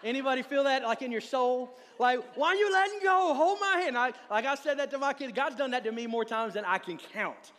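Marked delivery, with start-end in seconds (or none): 2.4-4.0 very high, loud mock panic; imitated frantic parent. Parent to child losing grip, hammed-up frantic cry. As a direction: mimic a parent urgently calling to a slipping child.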